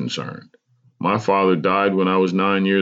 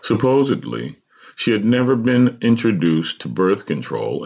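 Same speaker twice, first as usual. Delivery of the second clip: ordinary delivery, nothing marked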